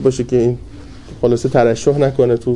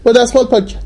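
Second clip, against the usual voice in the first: a mild mock shout, pitched high; retold shout; 10 semitones higher than usual. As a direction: call out high and urgent, reassuring them it's nothing. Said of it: "ایشون سری داد زد".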